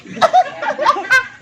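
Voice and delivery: high squealing burst of laughter. Real, not acted. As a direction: break up laughing at the joke. Laughing at the joke. (tawa melengking)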